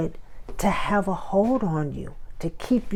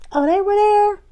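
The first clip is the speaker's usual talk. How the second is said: much higher, fake-surprised squeal; mock surprise; 5 dB louder than usual. Hearing who came to the party, high mock surprise. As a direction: squeal like you're shocked.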